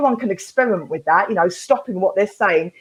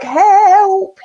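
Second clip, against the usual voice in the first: mock cry for help; 10 semitones higher, 7 dB louder than usual. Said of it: "I'm like".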